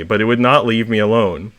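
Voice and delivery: usual speaking voice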